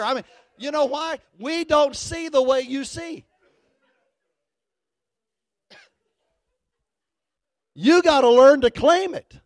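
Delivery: full preaching. Acted. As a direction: preach it out to the whole room.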